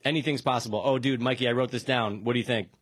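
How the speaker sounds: ordinary talk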